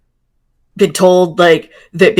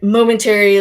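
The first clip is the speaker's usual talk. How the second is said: usual speaking voice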